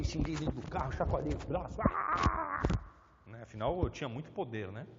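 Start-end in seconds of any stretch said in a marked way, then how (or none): 1.8-2.6 high angry outburst Ah; angry yell. Retold or imitated. Driver cut off in traffic, angry shout. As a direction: let out a frustrated roar. Fiquei bravo, esbravejei.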